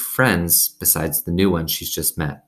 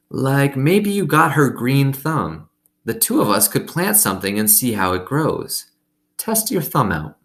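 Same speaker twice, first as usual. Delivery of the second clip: put-on old woman voice; 7 semitones higher than usual. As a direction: coax the child gently like an old woman. Gloria Dump speaks in story.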